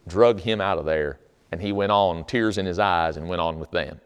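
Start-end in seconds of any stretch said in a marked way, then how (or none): none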